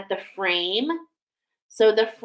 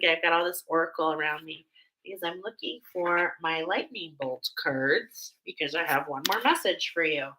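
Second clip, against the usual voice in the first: ordinary talk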